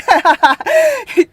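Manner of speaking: high, loud peal of laughter. Real, not acted. Host laughing after calling herself attractive, loud.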